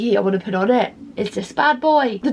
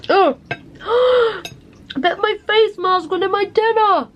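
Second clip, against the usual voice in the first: startled; 9 semitones higher, slower than usual. Real, not acted. Gasps at mask in food.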